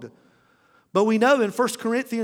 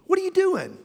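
much higher, sharp mock wife question; imitating the wife; 12 semitones higher than usual. Wife catching husband staring on the beach, high mock wife voice. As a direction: catch him out in a high wife's voice. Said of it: "She catches me".

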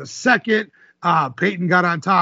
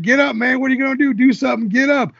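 put-on taunting Southern accent, pitched higher; mock Southern taunt; 7 semitones higher, faster than usual. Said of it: "He's got a real deep Southern accent".

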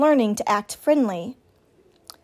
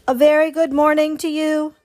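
higher, even robot greeting; friendly booming robot; 5 semitones higher, 4 dB louder than usual. A narrator's reading. Robot greeting the old goose over the pond, friendly boom. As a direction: hail them amiably across water. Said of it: "the robot's friendly voice boomed over the water".

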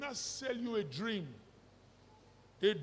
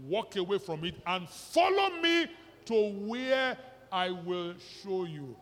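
preaching at full force; 5 dB louder, slower than usual. Acted. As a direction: build the list loud and slow, preacher style. Preaching build on leaving all.

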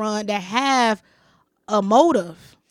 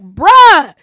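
loud, higher, drawn-out exclamations; exasperated warning; 7 semitones higher, 13 dB louder, much slower than usual. Retold or imitated. Woman retelling how she told the man to stop, exasperated. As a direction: act out telling him to back off.

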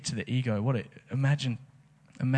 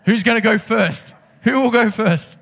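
much higher and louder pleading; mock eager call; 9 semitones higher, 6 dB louder than usual. Pastor playfully urging the congregation to go first, loud and high. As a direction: hype the crowd for a volunteer.

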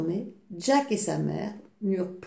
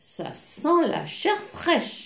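slow, higher sniffing giant; 7 semitones higher, slower than usual. Acted. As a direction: draw the words out, savouring the smell. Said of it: "s'écria-t-il".

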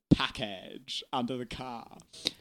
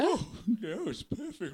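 soft, lightly voiced horse; a hint of a horse, hushed; quiet put-on animal voice; talking horse; 6 semitones higher, 5 dB quieter than usual. Plays the horse being tied up.

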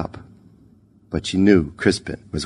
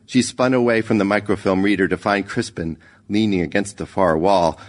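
plain reading voice

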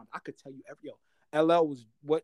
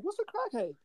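high, soft, puzzled question; clueless kid; 8 dB quieter than usual. Retold or imitated. Younger self asks friends about an unknown word, innocent puzzlement. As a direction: imitate a naive child, pitched way up.

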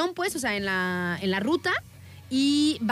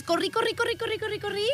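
breathless run; 9 semitones higher, faster than usual. Retold or imitated. Retelling frantic run.

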